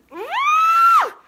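loud high-pitched scream; real shriek of fright; startled scream. Real, not acted. Woman startled by a mouse in her house, screaming.

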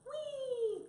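very high, loud squeal of delight; excited cry. A narrator's reading. Mouse spotting the circus wagons, crying out excitedly. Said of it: "cried Mouse".

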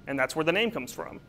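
normal talking voice

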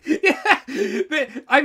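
high, loud laughter through the words; real laughter bubbling over; laughing. Real, not acted. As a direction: laugh while agreeing. Laughing at the advice.

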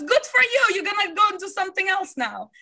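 high-pitched upbeat congratulation; imitated cheering dad. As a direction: imitate a father cheering on bad news. Retold dad cheering the bad news.

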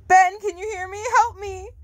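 high, crying call for help; tearful scream. Acted. Bound girl cries out to Ben, high and tearful. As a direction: cry out desperately for someone far away. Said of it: "You screamed at the top of your lungs".

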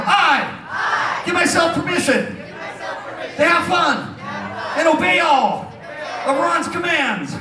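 high, stretched-out crowd call; yelled oath, slowly. Shown as a demonstration. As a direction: call out each phrase slowly for repeating. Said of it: "I'll talk slow".

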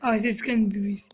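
ordinary delivery

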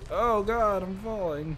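loud alarmed cry; panicked yelp mid-fall; screaming while falling. Real, not acted. Falls off a ledge.

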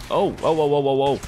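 high excited string of ohs; panicked ohs as the fight turns; frantic oh oh. Real, not acted. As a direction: yelp them out in a rush.

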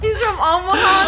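high-pitched laughing. Real, not acted. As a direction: laugh the words out. Laughing about Nebraska Ned.

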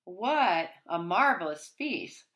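plain reading voice

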